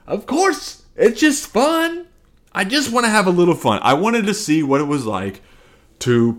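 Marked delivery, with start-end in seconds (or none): 0.1-3.8 inner voice. Retold or imitated. Thinking before provoking the crowd, high playful sing-song. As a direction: tease like a kid plotting a prank, much higher. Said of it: "this is going on in my head".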